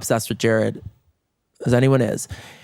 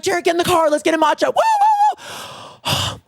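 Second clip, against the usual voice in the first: high, rushed fake-cheery urging; frantic hurry. Rushing husband into the car, high frantic cheer. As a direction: hustle him off with fake cheer.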